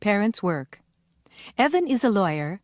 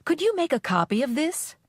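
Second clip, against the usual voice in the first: polite request; 7 semitones higher than usual. Shown as a demonstration. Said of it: "Write P for polite, I for impolite".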